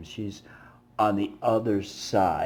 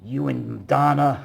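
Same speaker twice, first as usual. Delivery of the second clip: higher and louder, dragged out slowly; slow mock accusing yell; 6 semitones higher, 9 dB louder, slower than usual. Homeless woman accusing him of made-up names, slow loud yell. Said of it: "She's like".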